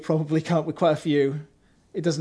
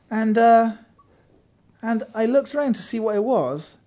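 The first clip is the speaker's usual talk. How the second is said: normal talking voice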